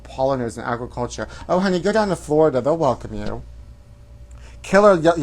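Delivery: ordinary delivery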